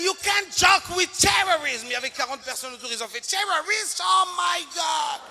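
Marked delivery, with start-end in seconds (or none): 0.0-1.8 high, loud, slow officer's shout; hammed-up angry policeman; stern officer. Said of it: "il m'a dit". 3.3-5.2 high, slow, alarmed cry; comic hammed-up panic; mock panic. Crowd panics in retold scene.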